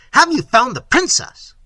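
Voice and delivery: loud, high, impatient question; insistent demand. Acted. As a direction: press the servants hard for news. Prince demands an answer again.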